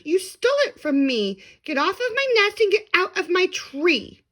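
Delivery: angry sputter. A narrator's reading. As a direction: order him off the nest. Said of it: "she sputtered".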